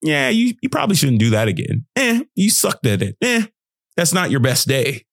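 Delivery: a hint of a dismissive critic; belittling critic. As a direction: brush the kid off with scorn. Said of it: "Because somebody said".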